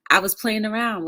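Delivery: ordinary delivery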